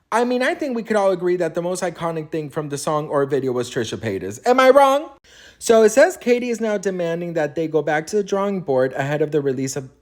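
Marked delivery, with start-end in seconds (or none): 4.5-5.1 sassy challenge. Real, not acted. Rhetorical challenge to viewers.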